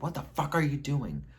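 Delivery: acted-out angry challenge; angry confrontation. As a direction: demand it, as in a retold quarrel. Retold quarrel, man angrily confronts him.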